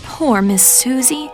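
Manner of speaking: usual speaking voice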